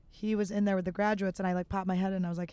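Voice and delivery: ordinary talk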